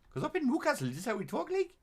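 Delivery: exaggerated high Geordie accent. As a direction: say it like you're from Newcastle.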